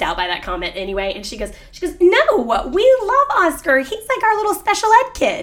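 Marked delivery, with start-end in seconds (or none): 2.0-5.4 much higher, put-on sweet voice of the dog trainer; mock trainer voice. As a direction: squeak it out like a fake-nice trainer. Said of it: "I can't believe you're saying it in that voice".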